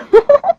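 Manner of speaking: high laugh at someone's expense; mocking laugh. A narrator's reading. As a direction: laugh at your own catty joke. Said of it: "listening to Tanya laugh".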